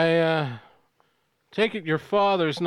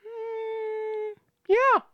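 high mock villain voice. Villain answering hostage.

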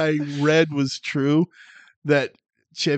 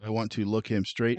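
flat monotone read, no energy; deadpan monotone; 4 semitones lower, 7 dB quieter than usual. Pretend. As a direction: read it like you don't care. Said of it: "monotone read".

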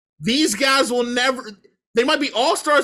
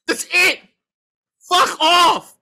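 angry shout; 6 semitones higher, 5 dB louder, slower than usual. Real, not acted. Ranting at team owners.

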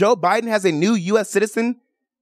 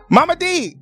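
high, playful call to mom; 9 semitones higher than usual. Pretend. Host calling on Mama for the answer, playful call. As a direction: summon Mama for help.

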